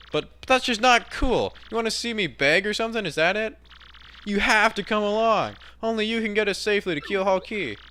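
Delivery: high, insistent partner voice; high-pitched, exasperated appeal; pleading sidekick. Acted. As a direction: beg in a high, protesting voice.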